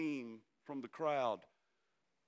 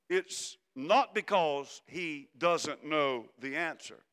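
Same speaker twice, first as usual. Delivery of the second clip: ordinary talk, nothing marked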